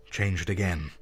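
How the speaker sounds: plain reading voice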